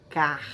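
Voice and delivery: "car" alone, ending on an audible R; syllable closed by R. Shown as a demonstration. Teacher showing R in the first syllable, "car" said alone. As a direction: isolate "car" and sound its final R. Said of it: "ele faz parte da primeira sílaba".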